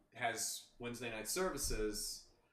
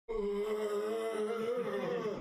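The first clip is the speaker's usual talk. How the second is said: sick groan; 10 semitones higher, 6 dB louder than usual. Retold or imitated. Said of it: "and I'm like".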